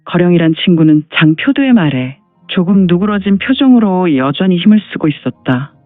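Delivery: normal talking voice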